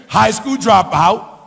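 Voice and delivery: normal talking voice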